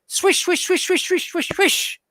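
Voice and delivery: high whooshing sword swishes; swish sound effect. Retold or imitated. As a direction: voice the blade cutting air.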